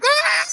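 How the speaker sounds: high-pitched girlish mock scream; mock excited squeal. Girl watching wild dance moves, mock excited squeal. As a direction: squeal like an overexcited onlooker. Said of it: "you see her, she's like".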